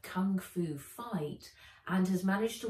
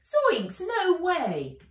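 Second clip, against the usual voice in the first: put-on high, loud girl's voice; a plucky girl saying no; girl refusing; 10 semitones higher, 9 dB louder, much slower than usual. Nat refusing the elf's price, high and loud.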